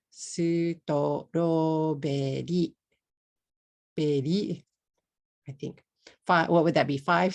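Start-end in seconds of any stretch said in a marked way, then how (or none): 0.1-4.6 "sutoroberi" slowly, su-to-ro-be-ri; katakana English. Shown as a demonstration. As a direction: separate each vowel in "sutoroberi", tapping the r. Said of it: "In katakana, it will be".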